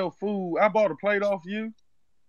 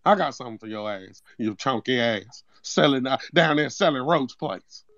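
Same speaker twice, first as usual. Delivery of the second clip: lower, menacing Zeke voice; low threat in accent; 10 semitones lower than usual. Pretend. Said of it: "why is he talking like Zeke off power".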